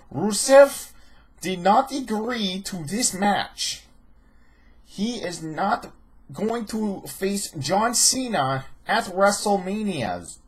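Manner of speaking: high, slow fake Russian accent; mock Russian accent. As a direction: imitate a drawn-out, bad Russian accent. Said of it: "he does that accent".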